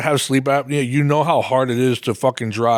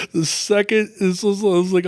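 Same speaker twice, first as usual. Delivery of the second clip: laughing; 6 semitones higher than usual. Real, not acted. Laughing continues.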